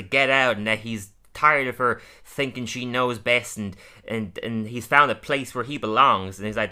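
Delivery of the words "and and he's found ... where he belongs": normal talking voice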